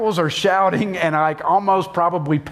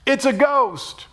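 terrified cry; 11 semitones higher, slower than usual. Acted. Disciples' terrified first thought.

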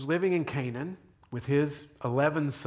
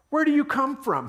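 sharp, high and louder demand; harsh question; 11 semitones higher, 6 dB louder than usual. A narrator's reading. Joseph interrogates the brothers, harsh and much louder. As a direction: Joseph snaps at his brothers as a stranger. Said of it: "he spoke harshly to them".

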